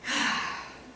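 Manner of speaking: long breathy sigh; demonstrated calming exhale. Shown as a demonstration. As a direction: let out a slow group breath. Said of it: "we just sit in a circle and we go".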